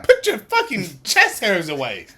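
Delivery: loud, high mock scolding; hammed-up shocked telling-off; mock outrage. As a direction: yell it like a scandalised prude. Mock outrage at a bare chest.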